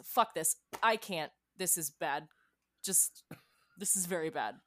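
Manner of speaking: normal talking voice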